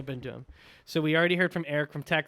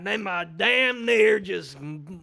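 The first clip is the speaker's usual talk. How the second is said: put-on South Carolina accent; 3 semitones higher, 4 dB louder than usual. Host retelling his slip, exaggerated South Carolina accent. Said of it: "I was doing my South Carolina accent".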